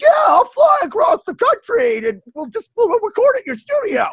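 eager high voice. Retold or imitated. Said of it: "she's like".

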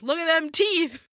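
a mock yell from across the way; mock shout. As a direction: shout it over to the other guy. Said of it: "they're both shouting at each other".

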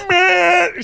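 high drawn-out groan of man; exasperated man. Retold or imitated. Stuck behind Karen in drive-through, high exasperated groan. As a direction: whine it like a horn blast. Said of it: "put my hand on my horn".

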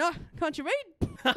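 slow, high-pitched retort; snappy comeback. Retold or imitated. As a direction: draw out a cheeky comeback. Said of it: "I went".

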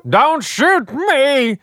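high, slow hammed-up Southern plea; mock Southern accent. Co-host quoting Bruce Willis's terrible Southern accent. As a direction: beg like a bad Southern hostage. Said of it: "his Southern accent was terrible".

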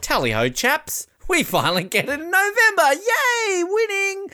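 high, hammed-up jolly toff; mocking the headline writers as cheery toffs; mock plummy Brit. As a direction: cheer it like a smug English chap. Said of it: "they were just like".

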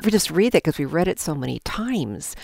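usual speaking voice

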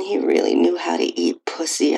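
plain reading voice

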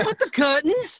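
high, squeaky Prince Herbert quip; high pretend prince puzzled about the curtains. Prince Herbert line from Spamalot.

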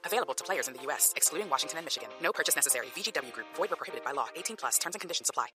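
rapid-fire fine print; fast legal disclaimer. Acted. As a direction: rattle off the legal small print. Fine print read at the ad's end.

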